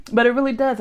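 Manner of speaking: laughing loudly, then insisting; bursting out laughing. Real, not acted.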